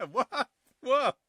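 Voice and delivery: high, disbelieving double what; incredulous. Real, not acted. Host reacting to a funeral joke, high incredulous. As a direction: squeak it out in shock.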